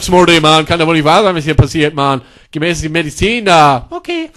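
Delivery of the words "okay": tiny high squeak, very soft; meek pharmacy girl. Acted. (kleinlautes, hohes Piepsen)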